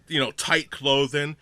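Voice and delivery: ordinary delivery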